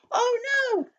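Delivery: high-pitched storybook alarm; alarmed yell. A narrator's reading. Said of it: "yelled Lucy".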